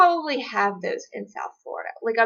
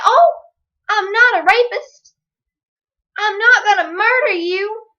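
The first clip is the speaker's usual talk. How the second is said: mock panhandler; 10 semitones higher, 8 dB louder than usual. Panhandler at her car window.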